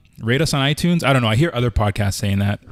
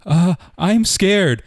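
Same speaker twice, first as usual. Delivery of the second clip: put-on scared child; 8 semitones higher, 4 dB louder, much slower than usual. Child voiced in retold call.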